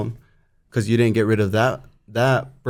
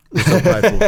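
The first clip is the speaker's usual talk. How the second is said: laughing; 9 semitones higher, 8 dB louder than usual. Real, not acted. Laughing at family pride.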